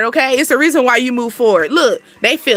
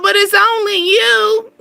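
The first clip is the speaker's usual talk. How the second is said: slow, high, mock-whiny "But it's only you."; mock pleading lover; 7 semitones higher, slower than usual. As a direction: mimic a clingy ex begging, drawn out and high. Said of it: "Talking about".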